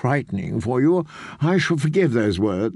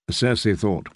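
usual speaking voice

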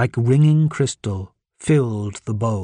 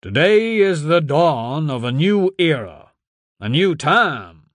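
slow, high, booming political speech; pompous senator oratory; 5 semitones higher, 3 dB louder, slower than usual. A narrator's reading. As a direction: orate grandly to a crowd from a stage. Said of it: "voice continued to roar through the microphone".

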